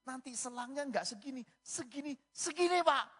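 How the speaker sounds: high nurse voice climbing to a loud peak. Retold or imitated. Nurse warning patient about a bigger tube, high and escalating to loud. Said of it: "Susternya ngomong lagi".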